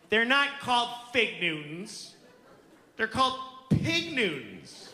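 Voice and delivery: high, slow, emphatic correction; drawn-out childish insistence; insistent little girl. Retold or imitated. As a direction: imitate a stubborn toddler laying down the law. Child correcting dad, imitated.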